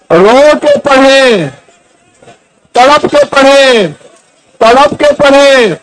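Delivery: a preacher working the crowd up, climbing in pitch; rising preaching exhortation. Acted. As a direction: build from urging to a fervent, pleading climax. Urges congregation to recite weeping.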